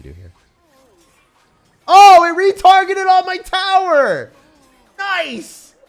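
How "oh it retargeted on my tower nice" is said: high, loud, excited yell; excited shout. Real, not acted. Streamer shrieks at a lucky play. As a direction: shriek with delight.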